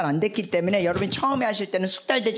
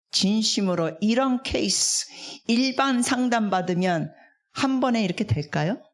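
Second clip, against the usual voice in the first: ordinary talk, nothing marked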